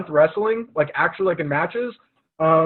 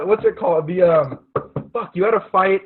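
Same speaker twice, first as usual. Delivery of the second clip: normal talking voice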